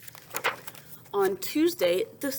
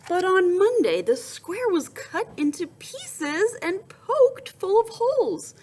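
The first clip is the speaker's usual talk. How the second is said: high and loud, announcing the plot twist; dramatic storytelling; 10 semitones higher, 6 dB louder than usual. A narrator's reading. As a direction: build drama on the bad news. Dramatic turn in read-aloud story.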